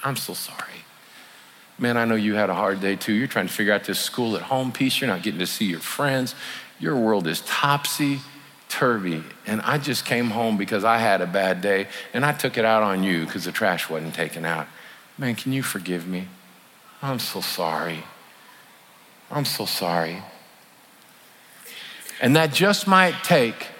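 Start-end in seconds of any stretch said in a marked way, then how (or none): 0.0-0.8 breathy whisper of I'm so sorry; whispered apology. Shown as a demonstration. Parent to child, whispered apology. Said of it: "With your kiddos". 1.8-20.2 quiet heartfelt apology, hushed; tender apology to a child. Shown as a demonstration. Parent apologizing to their kid over the trash, soft and tender. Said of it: "With your kiddos".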